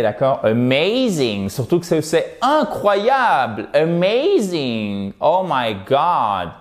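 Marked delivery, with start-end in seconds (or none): 0.4-1.5 AMAZING drawn out on a stressed MAY; slow, exaggerated AMAZING, second syllable swelling. Shown as a demonstration. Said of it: "exagérer". 2.0-6.5 slow, stretched-out wonder; hammed-up, drawling amazement; exaggerated amazement. Shown as a demonstration. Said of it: "exagérer".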